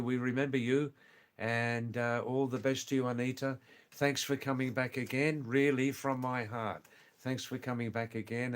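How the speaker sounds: ordinary talk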